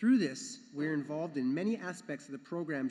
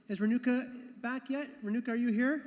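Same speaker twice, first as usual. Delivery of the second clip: raised, higher questioning call; 7 semitones higher, 4 dB louder than usual. Real, not acted. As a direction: call out to find someone in the hall. Calling out for absent person.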